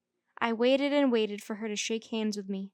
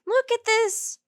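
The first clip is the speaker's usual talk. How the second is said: high, loud complaining whine; grouching; 10 semitones higher, 5 dB louder than usual. A narrator's reading. May grouching to her teacher about the sleeve, high and loud. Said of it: "she grouched".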